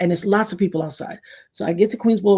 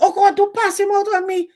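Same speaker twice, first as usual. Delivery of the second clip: much higher panicked neighbor voice; fearful neighbor; 13 semitones higher, 3 dB louder than usual. Retold or imitated. Neighbor fears the girl's words, high panicked squeal. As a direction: squeal like a scared superstitious neighbor.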